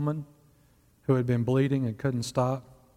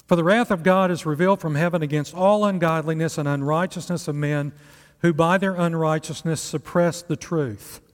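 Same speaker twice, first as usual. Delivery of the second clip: raised, louder reading from the pulpit; scripture reading; 3 semitones higher, 4 dB louder than usual. Acted. Preacher reading Romans one to the congregation, raised and louder. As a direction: read the verse out with weight.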